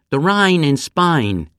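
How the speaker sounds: Cockney accent. Shown as a demonstration. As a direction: say it like a Cockney, vowel pulled wide. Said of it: "they say".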